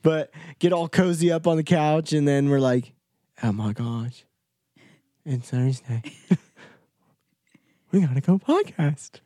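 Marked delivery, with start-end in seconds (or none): 3.4-6.0 low hushed dramatic gasp; mock gasp. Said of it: "was that?". 7.9-9.2 odd put-on voice, slightly higher; silly voice. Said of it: "why was that the voice you chose".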